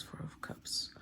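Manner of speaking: breathy whisper, barely audible. Real, not acted. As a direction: whisper the card name to yourself. Reading out a drawn tarot card.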